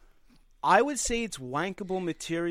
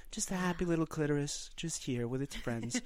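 calm painting voice; 8 dB quieter than usual. Retold or imitated. Painter soothing viewers in his videos, soft and calm. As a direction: lull like a gentle painting teacher. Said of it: "I'm very calm in the videos".

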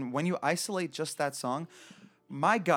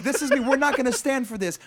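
higher and louder defiant declaration; the troupe standing up for themselves, loud mock defiance; 10 semitones higher, 7 dB louder than usual. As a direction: belt it as a hammed-up protest. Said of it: "they're like".

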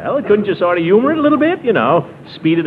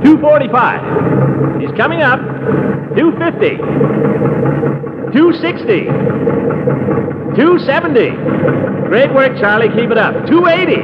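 rising pitch, getting more worked up; excited countdown; 8 semitones higher than usual. Acted. Reading the pressure gauge to Charlie, getting excited. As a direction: call out each number more thrilled than the last.

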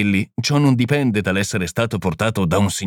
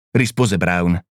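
ordinary delivery

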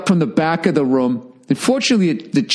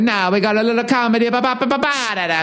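high, mocking put-on voice of a corny comic; sing-song mock of a bad opener; mock hacky comic; 8 semitones higher, faster than usual. Comedian mocking a fellow comic's corny patter, high put-on voice.

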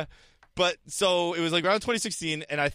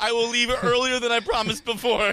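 higher, pleading mock prime minister; mock begging; 6 semitones higher than usual. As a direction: beg them like a desperate leader, laughing. Imitating Theresa May begging.

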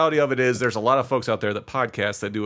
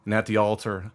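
low, measured scene narration; story narration; 3 semitones lower than usual. A narrator's reading. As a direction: set the scene like a game master.

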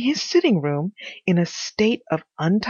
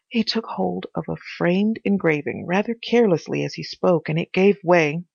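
ordinary delivery

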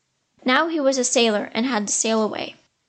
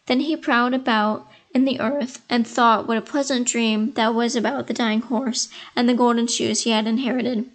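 usual speaking voice

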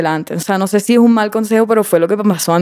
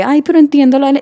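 higher-pitched mocking plea; imitating pleading friends; 6 semitones higher, 3 dB louder, faster than usual. Quoting the friends' excuse she hates, high mock plea. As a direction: mimic their excuse in a raised voice. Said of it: "me digan como que".

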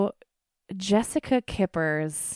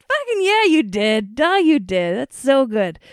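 higher and louder hype; excited cheer; 7 semitones higher, 9 dB louder than usual. Retold or imitated. Cheering Ty for directing the video, loudly. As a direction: cheer him on with a big grin. Her reply to Ty.

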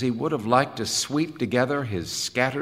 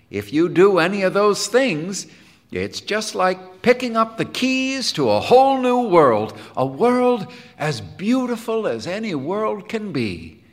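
high-pitched, rapturous pitch for music; eager, lifted, glowing delivery; enthusiastic; 9 semitones higher, 5 dB louder than usual. Acted. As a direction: sell the wonder of it. Helmholtz enthuses about music.

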